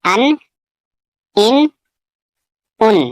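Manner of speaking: ان repeated with a clear nasal n, each one separate; teacher drilling ان with a held n; demonstrating tanween. Shown as a demonstration. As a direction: model ان three times, carefully. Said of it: "جیسے".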